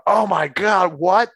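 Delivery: high, loud shocked outburst; shocked audience. Retold or imitated. Imitating moviegoers at a shock moment, loud astonishment. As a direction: gasp it out like a stunned crowd. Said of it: "people are like".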